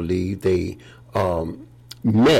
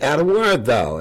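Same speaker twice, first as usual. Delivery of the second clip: God calling out; 6 semitones higher than usual. Retold or imitated.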